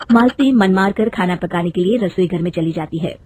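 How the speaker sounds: normal talking voice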